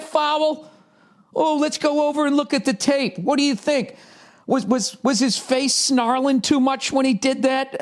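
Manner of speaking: high, fussy mock official; mock refs. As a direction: fret like a prissy referee. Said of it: "They'd be like".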